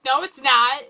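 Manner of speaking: loud, emphatic acted denial; mock contradiction. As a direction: contradict loudly, imitating a movie boss. Fury flatly contradicts Cap, retold.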